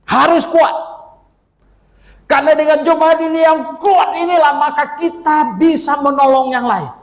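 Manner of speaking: loud, high preaching push; preaching at full force. Acted. (berkhotbah dengan suara lantang dan tinggi)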